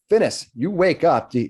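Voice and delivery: ordinary talk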